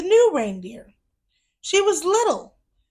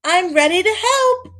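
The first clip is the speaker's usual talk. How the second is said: high, louder eager voice; 8 semitones higher, 6 dB louder than usual. Acted. Little elf eager to help his dad, excited. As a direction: chirp it out, ready to go. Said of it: "he said".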